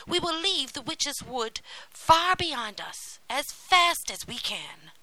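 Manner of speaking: put-on rally her as an eager boy; young Hansel. A narrator's reading. Hansel urges Gretel to flee, eager.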